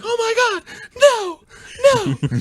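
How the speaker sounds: shrieking high woman's voice; panicked woman. Retold or imitated. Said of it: "this woman was like".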